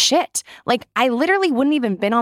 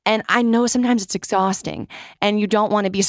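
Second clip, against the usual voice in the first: normal talking voice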